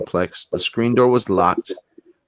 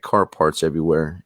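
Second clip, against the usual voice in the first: normal talking voice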